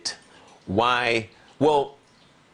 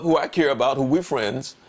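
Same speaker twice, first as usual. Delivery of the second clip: ordinary talk, nothing marked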